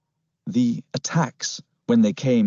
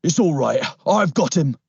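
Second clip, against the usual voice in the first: raised, urgent call; panicked cry; 6 semitones higher, 4 dB louder than usual. A narrator's reading. Policeman calls out as he grabs hold, panicked cry. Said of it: "A panicked voice cried".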